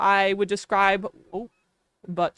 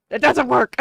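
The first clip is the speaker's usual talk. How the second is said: louder fed-up retort; exasperated; 2 semitones higher, 5 dB louder than usual. Retold or imitated. Owner answering useless advice, louder and fed up. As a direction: snap back, exasperated.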